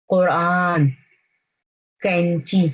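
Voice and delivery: normal talking voice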